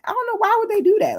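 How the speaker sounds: very high incredulous why; exasperated squeal. Real, not acted. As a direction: squeak it out in disbelief.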